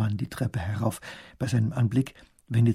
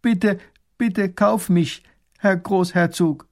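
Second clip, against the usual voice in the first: higher, slow desperate pleading; begging on knees; 7 semitones higher, 5 dB louder, slower than usual. A narrator's reading. Slave kneeling before the grand duke, pleading slowly. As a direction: beg for your life. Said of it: "Der Sklave fällt auf die Knie und fleht".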